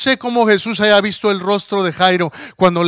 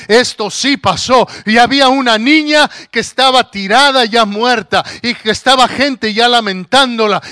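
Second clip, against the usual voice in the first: louder, higher fervent sermon; preaching at full force; 4 semitones higher, 6 dB louder than usual. Acted. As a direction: drive the message home to the church.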